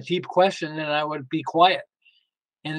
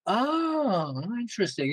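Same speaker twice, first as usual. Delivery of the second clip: drawn-out, higher, soft "oh"; dawning realisation; 4 semitones higher, 7 dB quieter, slower than usual. Retold or imitated. Learning that all capitals read as screaming, dawning realisation. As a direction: let it sink in, softly.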